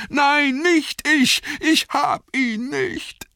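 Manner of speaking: very high, loud terrified denial; panisches Schreien. A narrator's reading. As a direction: shriek in panic, dragging out the final plea. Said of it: "schrie er". (schriller, verzweifelter Angstschrei)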